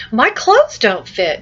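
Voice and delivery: high, loud, drawn-out excited reading. Shown as a demonstration. Teacher reads the boy's line to show fluency, excited. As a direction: read it as a surprised, excited boy. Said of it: "he's excited. He's, he's surprised".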